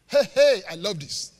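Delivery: ordinary delivery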